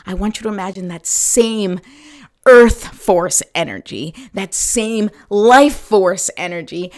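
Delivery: slow, swelling meditation voice; guided meditation. Acted. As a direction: guide the visualization slowly. Guided visualization.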